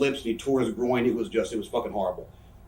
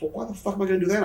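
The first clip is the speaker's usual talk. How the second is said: high, fast, indignant pushback; retold indignant protest; 9 semitones higher, much faster than usual. Wrestler retelling his argument over match spots, indignant protest. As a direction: argue back in a pitched-up, hurried mock protest.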